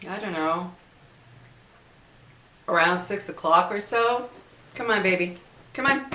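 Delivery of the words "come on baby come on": higher-pitched coaxing at the end. Real, not acted. Speaker calls baby over mid-story, coaxing. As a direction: coax a little one along, lifting the voice.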